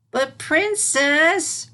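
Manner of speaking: high, slow protesting start; a child about to object; arguing. Acted. Filly starting to argue with Celestia, high and protesting. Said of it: "Sunset began to argue".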